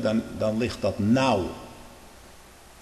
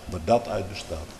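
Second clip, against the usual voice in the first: softer repeat, stress on "dat"; quiet echo; 5 semitones lower, 5 dB quieter than usual. Shown as a demonstration. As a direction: drop the voice on "dat". (zachte herhaling)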